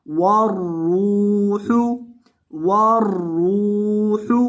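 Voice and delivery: वर्रूहु with the ra drawn out long and heavy. Shown as a demonstration. Teacher showing students how to lengthen the ra. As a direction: stretch the ra in वर्रूहु, slow. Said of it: "रॉ को लंबा करेंगे".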